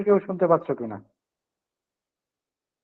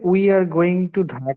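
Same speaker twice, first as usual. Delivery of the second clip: slow dictation; much slower than usual. Real, not acted. Teacher reads sentence to class.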